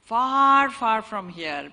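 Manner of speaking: "far" thrown out loud, then "far from here" slowed; drawn-out far. A narrator's reading. Storyteller opening the fable, loud then slowed "far, far". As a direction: stretch the "far, far" for distance.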